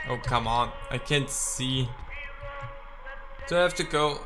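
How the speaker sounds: usual speaking voice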